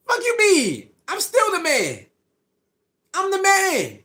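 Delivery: high-pitched, cocky comeback; indignant swagger. Retold or imitated. Acting out the player rejecting the humble question, indignant swagger. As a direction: imitate a player scoffing, pitch jumping up.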